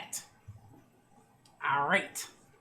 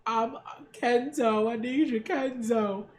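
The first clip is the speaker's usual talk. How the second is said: slow, higher, drawn-out mock plea; mock pleading; 3 semitones higher, slower than usual. Mimicking Eva begging Kenzo, mock pleading. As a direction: draw out the needy begging, hammed-up.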